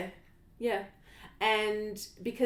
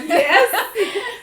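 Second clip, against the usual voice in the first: much higher and louder, laughing; mock eager yes; 12 semitones higher, 13 dB louder than usual. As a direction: gush like an eager mum. Mocking other eager mothers.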